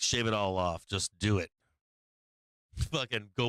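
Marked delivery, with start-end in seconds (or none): 0.0-1.5 lightly voiced pushy friend; imitating a friend's voice. Friend Bear urging him to shave, in a lower, mock-pushy voice. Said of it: "Be like".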